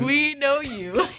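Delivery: slow, high, laughing repeat. Retold or imitated. The kids at the bus stop answering the old man, slow and laughing. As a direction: say it slowly through a laugh. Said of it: "we're like".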